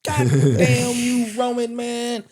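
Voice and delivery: mock cursing. Fan to a podcast co-host, mock anger at Roman. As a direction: scold Roman half-jokingly.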